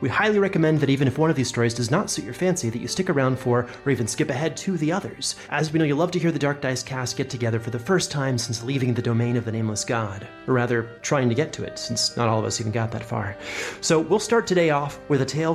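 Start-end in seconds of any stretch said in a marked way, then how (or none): none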